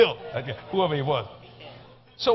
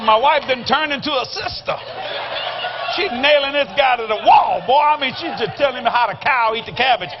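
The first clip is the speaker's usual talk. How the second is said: loud, high, worked-up exclaiming; comic astonishment; 6 semitones higher, 7 dB louder than usual. Retold or imitated. Husband watching his wife nail this guy, comic astonishment. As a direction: marvel at the top of your voice. Comic alarm at wife's tirade.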